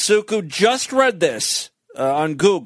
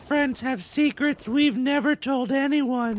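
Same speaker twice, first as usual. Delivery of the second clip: breathy woman voice; 8 semitones higher, 3 dB quieter than usual. Retold or imitated. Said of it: "the girl speaking".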